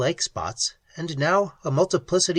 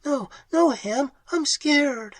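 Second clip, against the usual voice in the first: much higher, slow, frightened plea; 13 semitones higher than usual. Acted. Pat begging Ham to leave, frightened and high. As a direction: beg him to stop.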